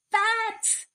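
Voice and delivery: very high shriek of fright; terrified cry. A narrator's reading. Thieves spotting the bats, shrieking high in panic. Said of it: "they cried".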